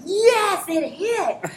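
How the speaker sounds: high, bright self-cheer; excited triumph. Retold or imitated. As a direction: celebrate that the joke landed. Retold triumph.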